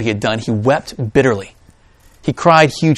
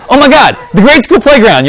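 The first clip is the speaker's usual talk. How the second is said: loud, higher mock horror at a memory; mock horrified flashback; 10 semitones higher, 9 dB louder than usual. Preacher acting out a cringing flashback, loud mock horror. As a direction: wince out loud at an old embarrassment.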